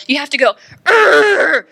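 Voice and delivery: fierce mock "Urgh!" pushed out high and loud; imitated battle grunt. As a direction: growl it like a fighter gearing up for war. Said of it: "like that".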